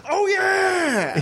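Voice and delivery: huge high "Oh, yeah!" yell; Kool-Aid Man shout. Acted.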